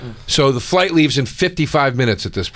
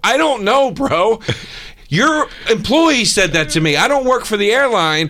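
heated raised retort; 9 semitones higher than usual. Retold or imitated. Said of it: "And I go".

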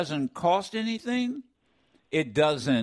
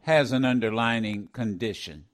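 lower, mock-indignant governor protesting; voicing the governor; 8 semitones lower than usual. Host voices the governor defending his sick wife, mock-indignant and lower. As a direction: play the governor objecting, a lower voice.

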